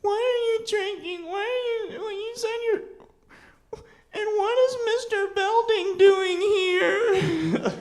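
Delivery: put-on very high, wailing child's voice, half crying; hammed-up sobbing little boy; freaking-out kid. Ten-year-old boy panicking to his drunk mom in a very high wailing voice. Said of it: "I was like".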